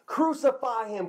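high-pitched mock crowd yell, first cry. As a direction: call it out high like an angry crowd. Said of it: "They yelled out".

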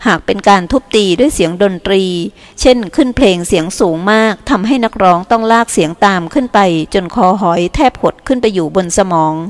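plain reading voice

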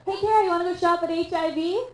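mock loud, high mom voice; imitating loud mom. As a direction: call out obliviously for the whole mall to hear. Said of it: "Like, loudly in a mall".

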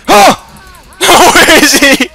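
high loud startled cry; startled yell. Real, not acted. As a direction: cry out in surprise. Startled search for attacker.

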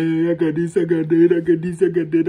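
showy boastful voice listing what he owns; mock bragging guy. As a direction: parody a show-off flexing his stuff. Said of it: "I don't wanna be like".

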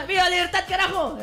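high, shouted Argentine rally cry; Argentine politician shout. Retold or imitated. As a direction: yell it like a fiery Argentine politician. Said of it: "Ese es de Argentina".

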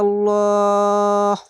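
slow chant ending in a held stop; Quran recitation. Shown as a demonstration. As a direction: recite and pause on the last sound. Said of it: "এখানে থামতে হবে".

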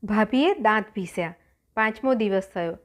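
usual speaking voice

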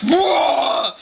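high, drawn-out whoosh of a sound; long mock blast noise; imitated airbag bursting. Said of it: "when it deployed, was like".

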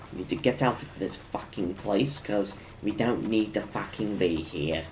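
put-on quiet old granny voice. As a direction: softly talk like a little old granny. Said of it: "I'm talking like an old granny".

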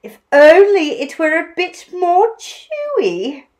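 high, wistful complaint; lightly sighing emu. A narrator's reading. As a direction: voice a theatrical regret as Emu. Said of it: "she sighed".

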